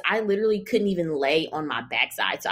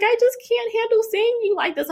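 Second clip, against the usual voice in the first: mom's voice; 3 dB louder than usual. Retold or imitated. Mom quoted, high far voice.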